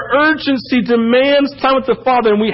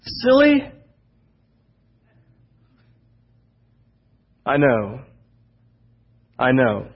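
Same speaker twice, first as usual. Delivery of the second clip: tender reassurance; slower than usual. Pretend. God soothing his whining child, tenderly. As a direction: hush them like a father. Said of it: "He says".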